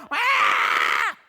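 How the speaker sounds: dramatic shout. Real, not acted.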